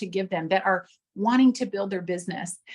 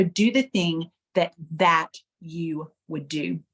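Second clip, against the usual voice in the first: second "that" set apart and stressed; slow down and hit "that"; emphatic THAT, slow falling "you would do"; 3 semitones lower, slower than usual. Shown as a demonstration. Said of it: "put the emphasis on a different word".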